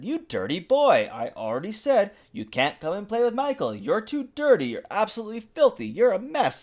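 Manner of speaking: high, fussy mom scolding; high scolding mother. Acted. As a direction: shoo the grubby kid away in a high voice. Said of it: "his mother opened up the door and said".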